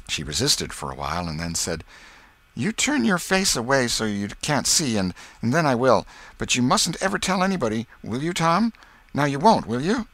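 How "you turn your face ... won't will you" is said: put-on high, insistent little-girl voice; bashful girl Becky. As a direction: make him promise, girlishly. Becky gives in, anxiously.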